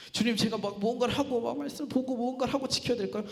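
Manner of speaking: worried, run-on plea; anxious pleading. Retold or imitated. Acting himself anxiously asking Jesus.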